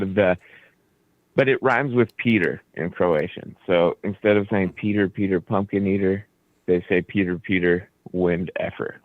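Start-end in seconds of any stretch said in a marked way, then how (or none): none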